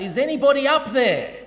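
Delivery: high, loud desperate call upward; shouting for help. Retold or imitated. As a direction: yell it up the cliff face, hoping someone hears. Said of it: "and he shouts out".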